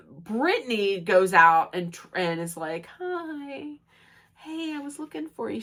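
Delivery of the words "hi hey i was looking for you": high, soft, tentative greeting; gentle approach. Retold or imitated. Brittany finds upset Lisa on deck, high soft greeting. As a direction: tiptoe in with a small, light voice.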